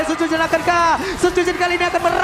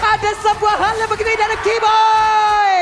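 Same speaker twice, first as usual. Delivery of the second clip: loud, slow hype call; excited shout; 5 semitones higher, 5 dB louder, slower than usual. Real, not acted. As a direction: shout it like a big highlight. Caster shouts at big play.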